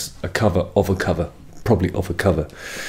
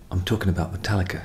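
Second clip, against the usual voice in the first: ordinary delivery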